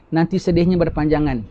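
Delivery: ordinary delivery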